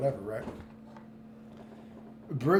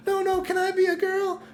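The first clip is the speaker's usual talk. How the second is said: much higher squeaky mock plea; mocking Bridget. Streamer mocking Bridget's plea to society, squeaky high. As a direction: whine it like a pleading kid. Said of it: "They're like".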